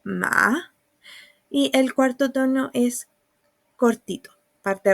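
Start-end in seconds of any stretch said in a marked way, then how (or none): 0.0-0.7 "Ma" dipping down, then climbing; third tone. Shown as a demonstration. Teacher tracing the third tone on the chart, dipping-rising "ma". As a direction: let "Ma" fall low and swing back up. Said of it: "baja y vuelve a subir".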